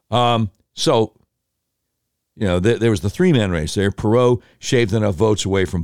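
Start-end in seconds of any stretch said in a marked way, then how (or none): none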